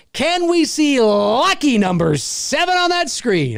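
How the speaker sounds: high, drawn-out game show host voice. Acted. Streamer hyping chat like a game show host. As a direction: hype it up like a TV host.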